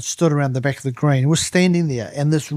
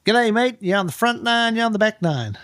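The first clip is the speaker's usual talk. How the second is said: high, sing-song marshal calling out; a cheery course attendant directing traffic; lightly mocked Aussie-style usher call; attendant's call; 8 semitones higher than usual. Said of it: "there's a guy going".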